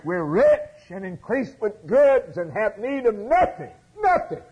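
high, loud, self-satisfied boasting; smug church boast. Retold or imitated. Preacher mocking the Laodicean church, high loud boast. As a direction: crow it like a proud rich church. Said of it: "We're saying, like that Laodicean church".

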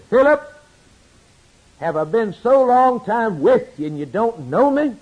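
loud, high, rebuking cry to a disciple; Jesus rebuking Philip, loud and high; stern rebuke. Acted. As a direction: call him out sharply and loudly. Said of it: "didn't hesitate to rebuke Philip".

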